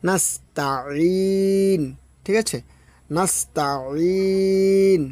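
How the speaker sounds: নাস্তাইন drawn out very slowly, ইন pressed from the mid throat; ain sound. Shown as a demonstration. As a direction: stretch নাস্তাইন, squeezing the ain. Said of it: "গলা চেপে আদায় হবে আইন".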